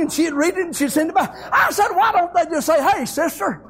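high, laughing storytelling; laughing retelling. Retold or imitated. Preacher telling the church about teenagers texting, amused laughing. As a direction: chuckle through the tale.

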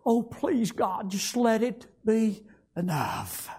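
high, crying plea to God, breaking into sobs; sobbing prayer that fades to a hush at the end; weeping prayer. Retold or imitated. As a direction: cry out a desperate prayer, weeping. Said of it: "just weeping and crying".

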